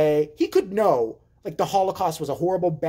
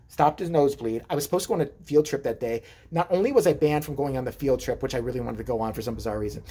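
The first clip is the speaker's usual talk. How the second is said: ordinary talk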